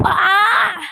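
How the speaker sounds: sudden high, loud yelp; startled shout. Real, not acted. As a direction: yelp in shock. Sudden game event.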